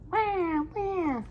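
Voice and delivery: put-on high wailing baby cries; mock baby crying. Reader imitating babies screaming at bedtime, high mock wail. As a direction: wail like a baby who can't sleep.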